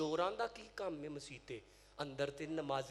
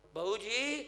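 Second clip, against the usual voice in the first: raised, higher call to the boss; 4 semitones higher, 5 dB louder, much slower than usual. Acted. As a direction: call to get his attention. Thieves calling their boss.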